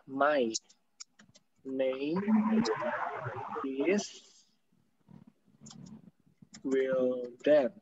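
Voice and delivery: very slow, each word apart and soft. Shown as a demonstration. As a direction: pause between "My", "name", "is", "Wildan". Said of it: "ini pronunciation-nya kan gini ya".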